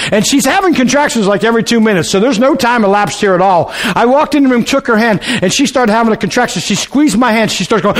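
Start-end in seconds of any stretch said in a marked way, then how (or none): none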